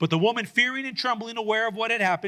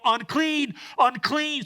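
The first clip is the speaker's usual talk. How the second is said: mock yell; 6 semitones higher, slower than usual. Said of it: "she has to cover her mouth and yell".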